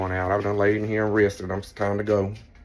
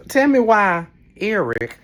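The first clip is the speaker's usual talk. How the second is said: high, slow, loud call-out; teasing accusation; 9 semitones higher, 5 dB louder, slower than usual. Real, not acted. Calling out her husband Eric on camera, playfully accusing. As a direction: drag it out to tease him.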